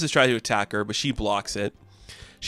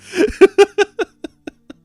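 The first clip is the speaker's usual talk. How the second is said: loud, high helpless laugh; squealing laughing fit; real high laughter; 7 dB louder than usual. Real, not acted. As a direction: crack up uncontrollably. Cracks up at own retelling.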